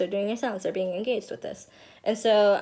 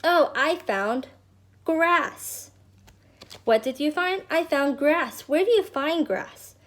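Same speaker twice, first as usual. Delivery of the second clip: put-on kid voice; 6 semitones higher than usual. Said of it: "they're like".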